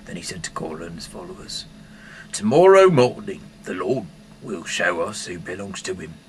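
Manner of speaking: ordinary delivery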